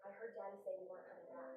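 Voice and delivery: ordinary delivery, nothing marked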